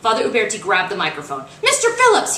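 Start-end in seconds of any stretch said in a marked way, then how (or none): 1.6-2.4 much higher, loud call over the mic; urgent call. Acted. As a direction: summon him across the gym. Priest shouting into microphone.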